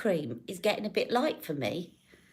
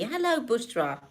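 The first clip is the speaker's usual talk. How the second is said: normal talking voice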